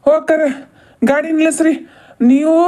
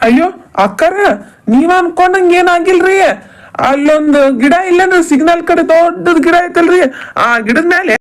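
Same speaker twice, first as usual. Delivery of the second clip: louder and higher, pleading to be heard; loud excited explaining; 4 semitones higher, 6 dB louder than usual. Acted. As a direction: shout the story over her. Man shouting an explanation to sister.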